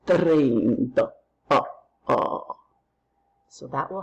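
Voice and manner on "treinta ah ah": Dracula. Shown as a demonstration. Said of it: "just like Dracula".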